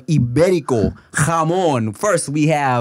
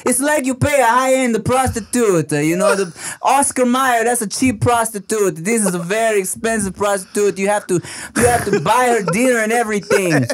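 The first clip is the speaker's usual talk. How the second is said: mock Italian salesman, pitched up; Italian accent; 4 semitones higher than usual. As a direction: explain it in a hammed-up Italian accent. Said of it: "we did an Italian accent".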